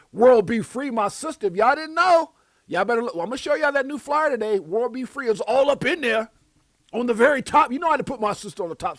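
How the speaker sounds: pumping up a friend on the flyer; hype shout-out. Real, not acted. Host hyping World Be Free to the chat, loud and high.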